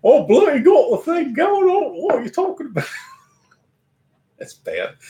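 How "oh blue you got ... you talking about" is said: much higher, hammed-up bad British accent; a deliberately terrible British accent. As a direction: put on a clumsy fake British accent. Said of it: "It's a bad British accent".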